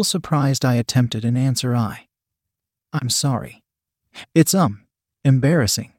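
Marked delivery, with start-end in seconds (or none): 1.8-5.9 halting, embarrassed apology; awkward. A narrator's reading. Embarrassed woman attempting an answer, awkward and halting. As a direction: falter between words, with pauses. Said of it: "I explained in my normally terrible manner".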